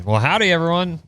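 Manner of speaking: high, twangy Texas country greeting; mock Texas country boy accent. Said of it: "Why do you give me a country boy accent?".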